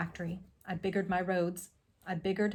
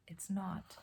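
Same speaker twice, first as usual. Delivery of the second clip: whisper; 9 dB quieter than usual. A narrator's reading. Grim conclusion.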